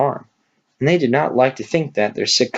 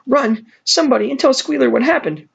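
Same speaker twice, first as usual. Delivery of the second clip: urgent call. Acted. Clover sends for help.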